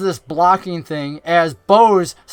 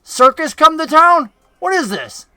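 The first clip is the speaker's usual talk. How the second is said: mild raised, higher-pitched goblin taunt; lightly voiced goblin, pitched up; 9 semitones higher, 3 dB louder than usual. Goblin sizing up the muscled mutant in his path, higher-pitched taunt. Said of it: "he goes".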